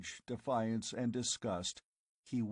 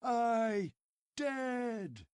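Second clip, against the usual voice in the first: crowd shout; 13 semitones higher than usual. Acted. Tribesmen shouting the news at Conan, furious. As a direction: fling it at him. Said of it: "voices assured him".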